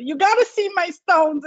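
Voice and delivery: high, eager call to the husband; urging him to come and look; thrilled and insistent; excited call. Retold or imitated.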